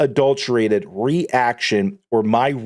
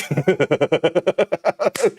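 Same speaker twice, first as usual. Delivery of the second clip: real amused laugh; a loose, breathy laugh; 2 semitones higher than usual. Real, not acted. Laughing at his own freedom-of-speech joke, real laugh.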